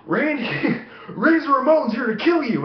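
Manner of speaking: high-pitched urgent wake-up call; dad's mock alarm. Dad waking his kid with a prank warning, mock alarm. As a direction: imitate dad raising a playful false alarm.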